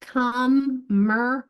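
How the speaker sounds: Commercial said slowly and clearly; teacher modelling Commercial; slow model word. Shown as a demonstration. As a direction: draw out Commercial syllable by syllable. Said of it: "that I is partnering with the C".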